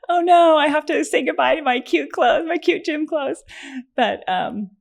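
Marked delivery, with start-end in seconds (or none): 0.1-3.4 high, hammed-up dismay; mock lament. Patient bids farewell to cute gym clothes, high mock lament. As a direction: wail a playful goodbye, pitched well up.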